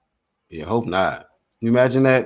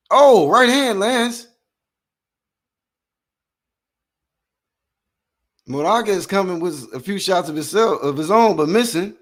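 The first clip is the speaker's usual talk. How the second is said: play-by-play; 6 semitones higher than usual. Acted. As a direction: lively fight commentary, pitched up on the big shots. Live play-by-play of the fight.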